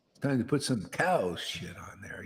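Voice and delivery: hushed whispered aside. Acted. Madhavendra Puri urging helpers to purify the deity, whispered. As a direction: lean in and whisper it.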